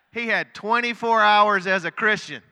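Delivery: high, very loud shout; shouted, high-pitched exclamation; exclaiming punchline. Real, not acted. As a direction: belt out the punchline to the whole room. Preacher's punchline of the convert story.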